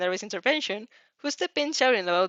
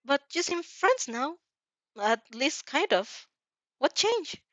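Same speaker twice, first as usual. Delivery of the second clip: curious Uraraka; 6 semitones higher, slower than usual. Acted. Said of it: "Uraraka blinks with curious eyes".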